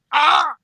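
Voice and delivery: sharp high yelp as he falls; a sudden pained shout; startled old man's cry; retold cry of pain. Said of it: "I hear the guy go".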